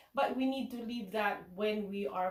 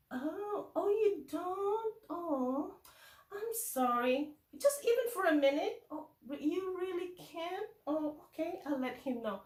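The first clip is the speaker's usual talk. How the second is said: pretend disappointment; 6 semitones higher than usual. Teacher pretends colleague can't take the pupil, mock disappointed. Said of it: "acted like talking to someone on the phone".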